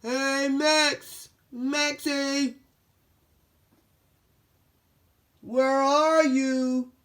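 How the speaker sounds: loud high call across the house. Acted. Dog calling his missing friend, loud and high. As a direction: call out for Max, drawn out. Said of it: "called Brody".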